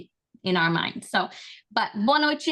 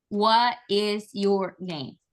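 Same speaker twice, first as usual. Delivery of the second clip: What is your name, slow and spelled out word by word; stiff textbook question; much slower than usual. Shown as a demonstration. Teacher mocking the stiff textbook question, slow. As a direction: ask it stiffly and slowly, every word separate. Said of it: "you don't ask them".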